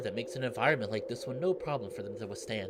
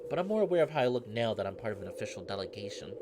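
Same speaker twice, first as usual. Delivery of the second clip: usual speaking voice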